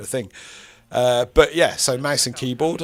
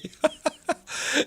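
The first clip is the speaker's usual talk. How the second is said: high laugh. Real, not acted. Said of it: "It's really bumpy ground".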